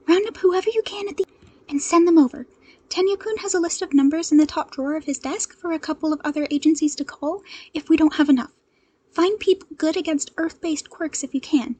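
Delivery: urgent hero call. Acted. Ochako radioing the agency for backup, urgent.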